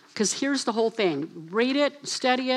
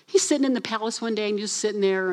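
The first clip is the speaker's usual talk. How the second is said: ordinary talk, nothing marked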